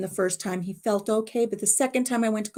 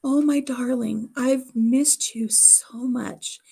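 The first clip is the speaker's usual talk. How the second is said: father telling his lost love he missed her, slow and tender; tender endearment; 4 semitones higher, much slower than usual. Retold or imitated. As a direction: savour each word like a reunion. Father's loving words to mother.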